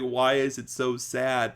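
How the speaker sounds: usual speaking voice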